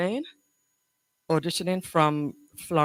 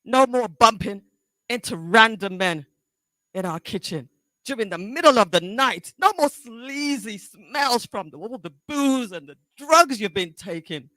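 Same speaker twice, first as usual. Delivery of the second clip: higher-pitched, halting confrontation with long pauses; anguished, accusing daughter; 13 semitones higher than usual. Acted. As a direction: confront Mom, close to tears, with bursts of force. Audition monologue, daughter confronting mother.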